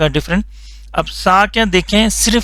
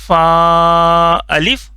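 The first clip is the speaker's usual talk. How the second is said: drawn-out syllable reading, slow and clear; slow teacher demo; much slower than usual. Shown as a demonstration. Teacher reciting the letter for students, slow and drawn out. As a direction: model the letter name slowly for the class. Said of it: "یہ یاد رکھنا ہے".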